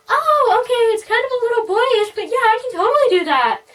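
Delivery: very high, loud, eager put-on voice; overly cheery hairdresser. Hairdresser agreeing to a requested haircut, overly cheery. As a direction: chirp agreement like an overeager stylist. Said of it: "she seemed overly cheery".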